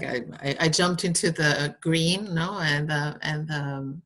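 normal talking voice

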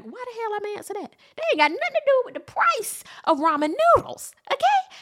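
high, loud indignant sass; exasperated sass. Retold or imitated. Answering the senator's hypothetical, high loud indignation. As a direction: scoff at the absurd question.

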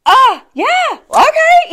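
awkward surprise. Retold or imitated. She is stuck for a reply to his weight remark, high awkward squeak. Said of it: "But I was like".

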